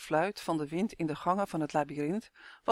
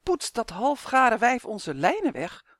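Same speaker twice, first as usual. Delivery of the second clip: indignant outburst; 5 semitones higher, 5 dB louder than usual. A narrator's reading. Justin outraged at the witch.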